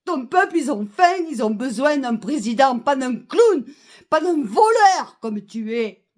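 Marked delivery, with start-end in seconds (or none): none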